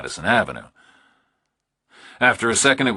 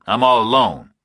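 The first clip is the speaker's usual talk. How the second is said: louder, higher, tense; tense Burke; 6 semitones higher, 7 dB louder than usual. A narrator's reading. Burke answering the kidnapper, tense and louder. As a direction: push through nerves.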